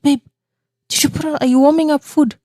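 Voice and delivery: high, quick call to husband; alarmed call. Retold or imitated. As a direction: call out worried. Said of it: "I'm like".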